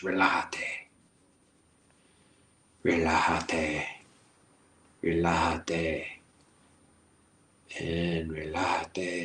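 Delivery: slow, soft relájate with a Spanish j, rolled out calmly; soothing Spanish relax. Shown as a demonstration. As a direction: breathe out "relájate" slowly and gently. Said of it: "say it in Spanish".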